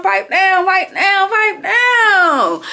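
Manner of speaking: high, mocking sing-song hush; mock pipe down. Teasing an imagined older hater, high mocking hush.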